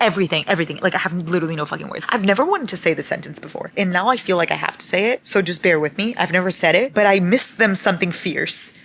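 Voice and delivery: normal talking voice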